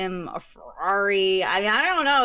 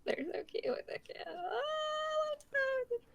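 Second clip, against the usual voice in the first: soft cooing; 12 dB quieter than usual. Real, not acted. Cooing over toads.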